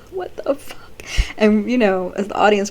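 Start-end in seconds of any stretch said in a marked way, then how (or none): 0.1-0.8 high stunned what the fuck; a mock jaw-drop, pitched up; shocked disbelief. Nate reacts in shock to the news. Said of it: "he's just like".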